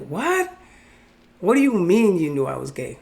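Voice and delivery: a jolt of surprise, voice jumping up; mock-stunned retelling; shocked disbelief. Retold shock at cousin's reply.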